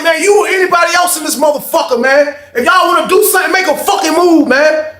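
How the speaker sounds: high, loud dare to the room; shouting a challenge to the whole cafeteria; high challenging shout. Retold or imitated. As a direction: square up to everyone. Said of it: "I was like".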